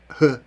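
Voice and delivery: "х" alone as a breathed h sound; the bare consonant "х", demonstrated on its own. Shown as a demonstration. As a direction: say "х" as a single isolated puff of h. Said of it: "вимовляється як звук".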